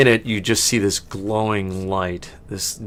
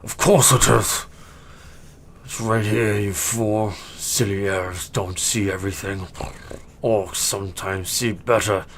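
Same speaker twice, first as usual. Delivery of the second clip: huffy orc. Acted. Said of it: "The orc goes".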